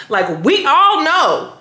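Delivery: ALL drawn out high, then falling; knowing emphasis. Shown as a demonstration. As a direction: lean slowly and pointedly on "all". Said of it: "felt like there was no history. So it needed to be more".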